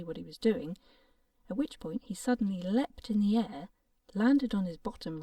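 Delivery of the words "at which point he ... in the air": ordinary delivery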